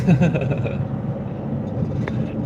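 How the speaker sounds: breathless wheezing laugh; breathy laugh. Real, not acted. Laughing after punchline.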